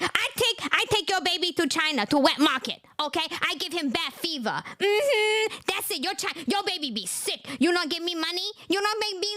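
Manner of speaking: broken-English impression with accented threats; mocked foreign woman. Mocking the mother threatening the father, accented broken-English impression. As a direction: play a scolding woman in choppy broken English. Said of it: "she's like".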